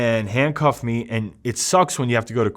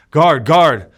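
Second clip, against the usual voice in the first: calls of guard growing louder and higher; yelling for the guard; 5 semitones higher, 7 dB louder, slower than usual. Retold or imitated. Calling the guard to get out of danger, rising yell. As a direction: shout over the cell noise for the guard. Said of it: "I'm yelling over him".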